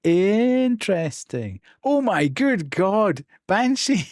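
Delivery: high, loud, drawn-out amazement; real gobsmacked reaction, slow and high; astonished delight. Real, not acted. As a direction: stretch each word in loud disbelief. Bot unexpectedly paid out.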